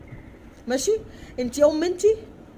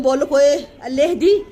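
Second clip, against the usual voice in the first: raised voice; 7 dB louder than usual. Retold or imitated. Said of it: "وصوته عالي عليا".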